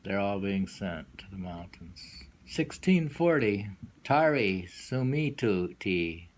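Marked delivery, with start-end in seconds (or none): none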